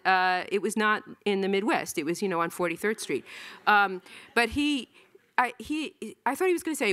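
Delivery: ordinary talk, nothing marked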